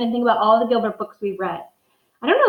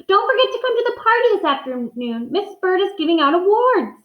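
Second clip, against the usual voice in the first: put-on higher, eager little boy voice; excited kid; 9 semitones higher, 3 dB louder than usual. Boy reminding his mother as he runs out, high and eager. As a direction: call back happily over your shoulder. Gilbert calls out leaving home.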